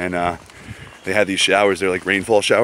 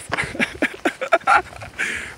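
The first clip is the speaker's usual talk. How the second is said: breathy laugh; 3 dB quieter than usual. Real, not acted. Laughs at own impression.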